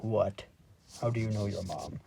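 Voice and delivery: low, quiet questioning; lightly voiced deadpan dad; mock puzzled dad. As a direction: ask it low and under-projected, like a doubtful father. Dad questions Buddy in retelling.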